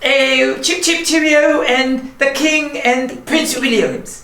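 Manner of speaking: clumsy fake British accent; mock British. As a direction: play at being British for the kids. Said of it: "the worst accent giver".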